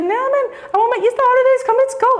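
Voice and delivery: put-on very high, loud lyrical Cape Town accent; [name]'s Cape Town voice. Friend urging her to come on holiday, lyrical Cape Town accent. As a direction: imitate an insistent Cape Town friend, singsong. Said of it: "exactly the way he sounds".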